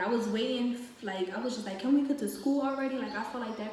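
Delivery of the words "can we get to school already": louder, higher exasperated plea; retold impatient whine, voice raised; imitated impatience. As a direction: act out wanting the ride over. Impatient in awkward car ride.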